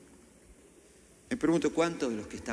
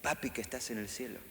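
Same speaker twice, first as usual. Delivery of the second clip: much softer, intimate "Papi que estás en el cielo"; tender prayer; 7 dB quieter than usual. Shown as a demonstration. How to start talking to God, soft and tender. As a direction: pray it gently like a child to dad. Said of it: "comiencen así".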